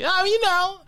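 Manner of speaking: imitating an evasive comic; hedging comedian. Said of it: "will take their time answering that".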